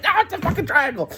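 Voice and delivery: high-pitched retold protest; frantic insistence. Passenger insisting to the doubting driver, acted frantic protest. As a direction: insist high, refusing to be calmed.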